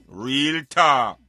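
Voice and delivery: ordinary talk, nothing marked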